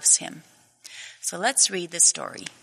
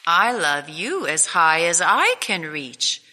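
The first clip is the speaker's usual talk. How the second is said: father hare outdoing his son, calm and firm; big hare; 6 dB louder than usual. Acted. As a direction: answer like a fond father topping his son. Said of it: "said Big Nut Brown Hare".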